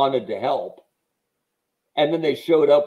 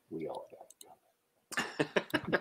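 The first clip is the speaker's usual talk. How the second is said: gritty movie line; 4 semitones higher, 12 dB quieter, slower than usual. Retold or imitated.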